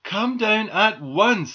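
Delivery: loud, high, drawn-out command; scolding order. A narrator's reading. Doll orders squirrel off the roof, scolding call. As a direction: order it firmly from down below.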